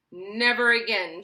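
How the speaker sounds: loud firm outburst; angry shout. A narrator's reading. Father shouts at his son, loud and final. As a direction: put your foot down hard. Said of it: "shouted Mister T V".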